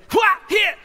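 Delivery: high, loud martial-arts yelps; short comic karate shouts; mock kung fu cries. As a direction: yelp like a kung fu fighter. Mock kung fu fighting noises.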